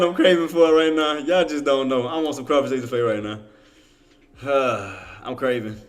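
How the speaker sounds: ordinary delivery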